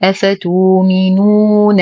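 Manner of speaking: أفتؤمنون recited slowly with the hamza turned to a long و; Warsh recitation. Shown as a demonstration. Teacher recites in Warsh reading for students, slow chant. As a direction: chant أفتؤمنون with no glottal stop. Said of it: "فتصبح واو مادية".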